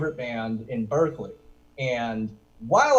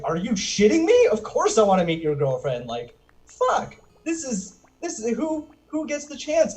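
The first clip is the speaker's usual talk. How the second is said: high, bursting disbelief; incredulous excitement; 7 semitones higher, 3 dB louder than usual. Acted. Fan offered a meeting with the girlfriend, incredulously excited. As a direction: act out being thrilled beyond belief. Acts out thrilled reply to offer.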